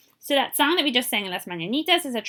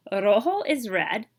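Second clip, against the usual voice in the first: ordinary delivery, nothing marked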